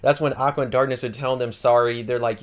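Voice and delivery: ordinary delivery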